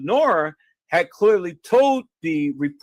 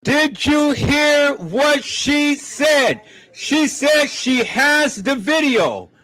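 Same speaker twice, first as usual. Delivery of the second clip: indignant shout; 6 semitones higher, slower than usual. Real, not acted. Excited retelling to live viewers.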